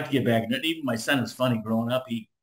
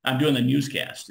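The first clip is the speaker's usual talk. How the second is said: ordinary delivery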